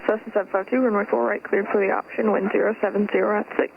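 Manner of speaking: ordinary delivery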